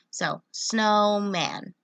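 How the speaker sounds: slow, beat by beat snowman; syllable clapping. Shown as a demonstration. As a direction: say "snowman" slowly, one chin tap per syllable. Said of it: "So hand under my chin".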